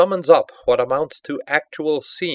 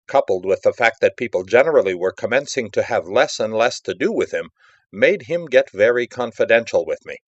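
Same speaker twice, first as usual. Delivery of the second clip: ordinary delivery, nothing marked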